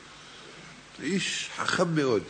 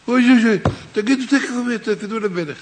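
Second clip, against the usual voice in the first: alarmed cry; 5 semitones higher, 5 dB louder than usual. Acted. Family panics over sick leader.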